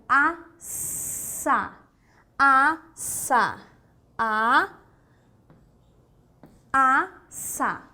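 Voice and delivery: "a, sa" broken into separate syllables with a clear hissed s; S sound demonstration. Shown as a demonstration. Teacher giving an example of S, syllable by syllable. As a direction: split "a, sa" with pauses, holding the s. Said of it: "que representam o som de S".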